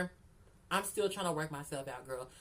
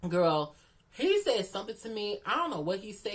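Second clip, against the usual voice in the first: ordinary talk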